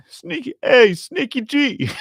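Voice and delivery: mock sly voice. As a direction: tease the nickname in a sly voice. Mock greeting to the nickname.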